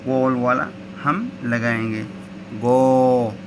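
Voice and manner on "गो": गो with a long held o; long o vowel. Shown as a demonstration. Teacher lengthening गो to show long o. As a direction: draw out the o in गो. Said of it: "ओ की आवाज़ आएगी लंबी".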